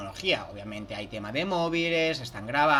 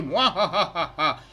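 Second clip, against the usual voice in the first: hammed-up evil cackle; mustache twirling villain laugh; 6 dB louder, much slower than usual. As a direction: cackle like a cartoon baddie. Mocks greedy company as cartoon villain.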